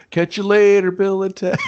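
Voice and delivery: high, loud, breezy mock dad farewell; mock dad goodbye. Dad ghost leaving his daughter, high and loud mock farewell. Dad's goodbye voiced mockingly.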